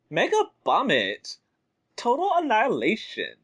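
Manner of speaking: put-on bubbly sorority girl; high, perky cartoon girl quote; Roxy voice. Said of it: "let's give you some of that classic Roxy dialogue".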